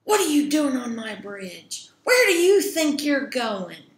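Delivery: loud, challenging troll shout; loud troll confrontation. Acted. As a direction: holler at a trespasser on your bridge. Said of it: "the troll jumps out ... and says".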